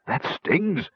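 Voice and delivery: high, small whimper of pain. A narrator's reading. Gnome waving his sore hands about, whimpering. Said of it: "Roperig whimpered".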